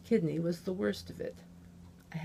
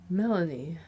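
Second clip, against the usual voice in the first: ordinary delivery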